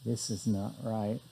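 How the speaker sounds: hollow tube-like mic sound; through a tube. Real, not acted. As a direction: voice muffled as if inside a tube. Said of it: "Sounds like I'm talking into a tube".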